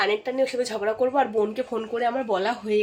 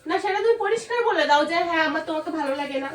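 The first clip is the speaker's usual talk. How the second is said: jealous accusation; 5 semitones higher than usual. Acted. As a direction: accuse him of cheating. Fake jealous quarrel.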